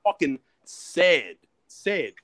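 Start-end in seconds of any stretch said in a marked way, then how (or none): none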